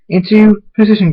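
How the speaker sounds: rally the team into place; called-out battle order; urgent command. Acted. Leader gives battle order.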